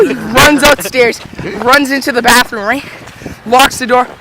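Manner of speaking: ordinary talk, nothing marked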